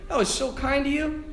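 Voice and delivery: high, tender thanks; sweet and gentle. Retold or imitated. Fallen man thanking the priest, sweetly. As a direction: imitate a gentle man's heartfelt gratitude. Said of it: "it was the sweetest, kindest, most gentle man".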